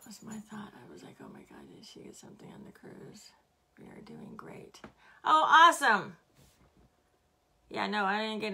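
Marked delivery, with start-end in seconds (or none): 0.1-4.8 soft unvoiced aside; whisper. Real, not acted. Host whispering an aside about the cruise. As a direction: whisper it so the stream barely hears.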